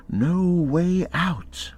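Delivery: ordinary delivery, nothing marked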